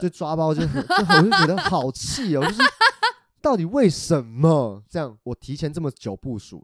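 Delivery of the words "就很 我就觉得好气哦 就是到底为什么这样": high, loud exasperated whine; playful outrage at being caught again; mock frustration. Real, not acted. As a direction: throw up your hands in exasperation, then laugh.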